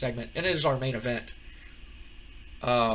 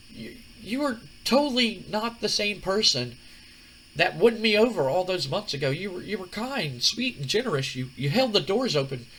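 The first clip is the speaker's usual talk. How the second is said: much higher reproachful woman's voice; Stephanie, reproachful; 7 semitones higher than usual. Retold or imitated. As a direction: list his lost virtues with hurt. Stephanie scolds The Rock.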